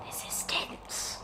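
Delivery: hushed whisper; whispering while peeling a sticker. Real, not acted. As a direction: whisper it as if concentrating.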